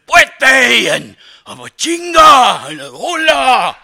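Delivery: hammed-up shouted war cries; playful burst of fake-Spanish hollering; mock macho yells. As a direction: shout out nonsense words with comic gusto. Mock shouting of team spirit words.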